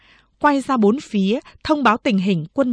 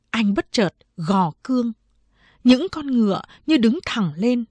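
ordinary delivery, nothing marked

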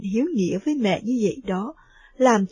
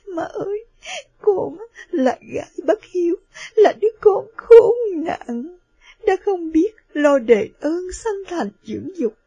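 sobbing; 4 semitones higher than usual. Acted. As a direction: break down begging her mother. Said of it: "và khóc và nói".

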